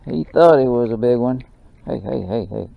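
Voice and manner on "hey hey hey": normal talking voice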